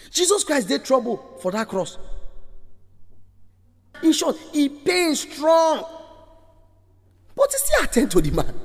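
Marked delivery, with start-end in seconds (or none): 4.0-8.5 pained cry. Real, not acted. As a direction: cry out his suffering. Preacher crying out.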